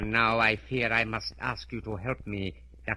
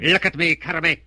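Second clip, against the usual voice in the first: loud, higher hypnotic command; 5 semitones higher, 10 dB louder than usual. Acted. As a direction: seize her will with a loud order. Fu Manchu commands hypnotized Carame.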